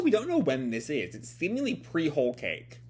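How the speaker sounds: ordinary talk, nothing marked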